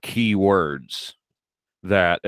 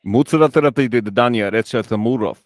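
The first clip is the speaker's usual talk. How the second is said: mock Russian patter, run together; Russian mumbling; 2 semitones higher, 3 dB louder than usual. Shown as a demonstration. Cohost asks for his Russian, Russian mumbling. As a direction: mumble made-up words in a Russian accent. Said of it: "Just do Russian mumbling".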